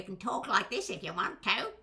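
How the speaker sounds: put-on voice swooping up at the end; playful voice demo. Shown as a demonstration. Voice coach showing what voices can do, playful put-on lilt. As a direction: play with the voice, lilt up to a rise. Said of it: "you can do things with it".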